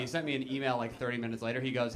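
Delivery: ordinary talk, nothing marked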